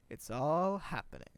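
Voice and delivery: loud, stretched-out mock excitement; quoted ad exclamation. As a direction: call it out, stretching ALL. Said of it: "I'm gonna quote it from memory".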